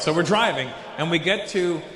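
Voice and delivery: ordinary talk, nothing marked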